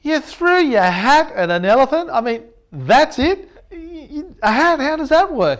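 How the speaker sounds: a high, loud, disbelieving outburst; can't believe a hat stopped it; incredulous. Retold or imitated. Asking Craig in disbelief about the thrown hat, high and loud. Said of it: "I said".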